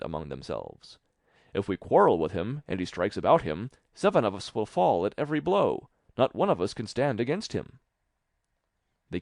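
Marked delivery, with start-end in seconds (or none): 1.5-7.8 louder, raised anxious voices; alarmed soldiers. Acted. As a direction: worry aloud, rising in alarm. Soldiers fear the tailor.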